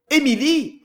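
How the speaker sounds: loud, high call of her name; shouting her name up the stairs. A narrator's reading. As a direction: call her from the next room. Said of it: "appela-t-il".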